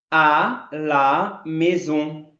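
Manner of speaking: very slow, stretched "à la maison"; very slow demo. Shown as a demonstration. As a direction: drag it out for learners. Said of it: "como é que eu vou pronunciar". ("à la maison" bem devagar)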